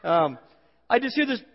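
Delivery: usual speaking voice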